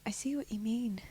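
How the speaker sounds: put-on very soft, understated Donegal accent; hushed, casual try at a Donegal accent; neutral Donegal accent. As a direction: slip into Donegal without trying too hard. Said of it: "You weren't trying too hard with that accent".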